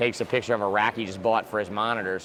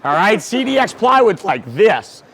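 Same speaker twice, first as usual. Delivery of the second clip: much higher and louder, slowing at the end; mock shout; 10 semitones higher, 11 dB louder, slower than usual. To complainers about modern racks, a hammed-up shout. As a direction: bark shut up like a fed-up old-timer. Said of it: "And I'm like".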